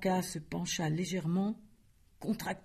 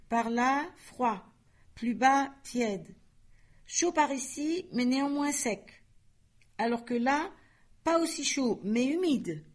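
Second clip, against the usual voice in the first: voix monocorde; 7 semitones higher than usual. Acted. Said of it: "Une voix monocorde".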